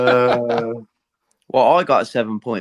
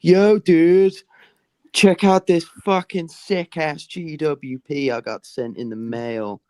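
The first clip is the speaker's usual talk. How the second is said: put-on American YouTuber accent; 5 semitones higher, slower than usual. Friends doing American accents, hammed-up YouTuber voice. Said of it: "do American Lego YouTuber accents".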